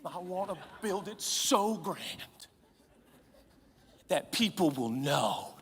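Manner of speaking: dream big and drift off into a whisper; dreamy young guy. Retold or imitated. Preacher imitating a young guy dreaming of a business, fading to a whisper. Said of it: "coffee with guys who say".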